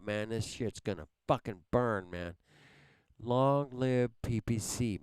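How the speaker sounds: ordinary delivery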